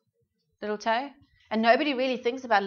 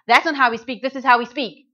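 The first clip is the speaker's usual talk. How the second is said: loud, sharp correcting snap; harsh scolding; 3 semitones higher, 11 dB louder than usual. Retold or imitated. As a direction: bark the correction at them like a scold. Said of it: "you actually haven't said it in the right tone".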